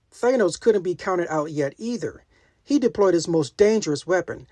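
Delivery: ordinary delivery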